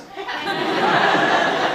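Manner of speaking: high breathy burst of laughter; real laugh. Real, not acted. Laughing as he tells the audience what the men said. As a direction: laugh out at the joke. Laughs at "never miss our wives.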